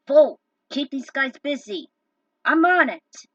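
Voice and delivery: loud shouted orders to a teammate; call to action. Acted. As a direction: yell it over the fight. Coe calls out in battle.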